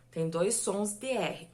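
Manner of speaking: normal talking voice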